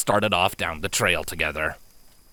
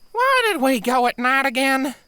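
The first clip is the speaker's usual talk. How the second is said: nervous; 3 dB louder than usual. Acted. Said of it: "asked nervously".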